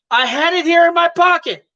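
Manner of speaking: husband's voice. Acted. Husband answers in skit.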